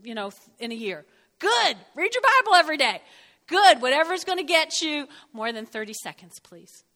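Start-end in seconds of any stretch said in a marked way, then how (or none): 1.4-3.8 loud, high "Good!"; emphatic praise. Real, not acted. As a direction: call it out to the congregation. Pastor praising, exclaiming loudly.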